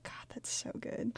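delighted whisper. Real, not acted. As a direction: hush it with glee. Gleeful reaction to Princess Switch.

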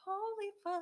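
the heart-drop moment, blurted high; shocked gasp. Retold or imitated. On the phone with mom, hearing college is unaffordable, shocked. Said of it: "I'm like".

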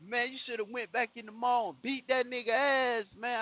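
high-pitched, indignant imitation; pissed-off brother. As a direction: act out your angry brother egging you on. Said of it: "my brother been pissed off".

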